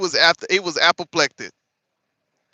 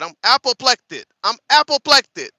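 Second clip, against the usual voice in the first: mock politician quote; 5 semitones higher, 5 dB louder than usual. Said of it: "That boy said".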